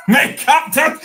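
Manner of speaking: cracking up mid-phrase, high and loud; laughing shout. Real, not acted. Laughing loudly at a chat comment. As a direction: blurt it out through laughter. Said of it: "laughing outburst".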